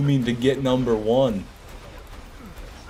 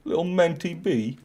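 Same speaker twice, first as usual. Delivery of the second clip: lightly put-on British accent, higher; a hint of a British voice saying the nickname; playful mock British; 6 semitones higher than usual. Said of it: "I can see a British person saying".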